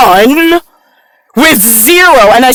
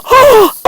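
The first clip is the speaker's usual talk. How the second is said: high sudden gasp of surprise; a startled high gasp; sharp gasp; 13 semitones higher than usual. Real, not acted. Sudden reaction to something seen.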